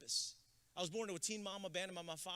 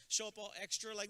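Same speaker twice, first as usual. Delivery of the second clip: ordinary delivery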